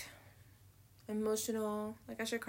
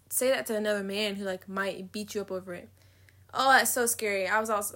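usual speaking voice

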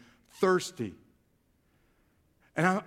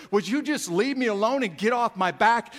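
raised, louder fed-up father; exasperated; 6 semitones higher, 6 dB louder than usual. Acted. Father pestered by his child for more, exasperated. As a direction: act out a parent at the end of their patience. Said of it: "that gets exasperated with you".

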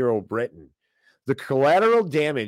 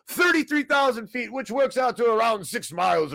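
high, hammed-up panic announcement; sarcastic doom-monger, pitched way up; mock alarm; 9 semitones higher than usual. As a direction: sound the alarm in an overblown high voice. Mock alarm about China's new plan.

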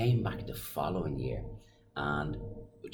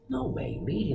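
high, squeaky mock surprise; surprised disbelief. Friend hearing the daughter wants to meet [name], high mock surprise. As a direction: jump way up in disbelief. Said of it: "And she goes".

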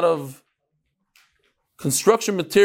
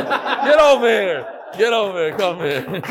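playful call; 3 semitones higher, slower than usual. Real, not acted. Calling a child up, laughing.

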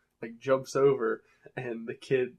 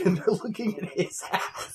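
normal talking voice

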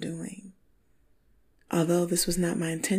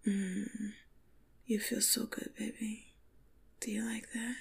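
sensual whisper; 4 semitones higher, 6 dB quieter than usual. Acted. Acted lovemaking dialogue.

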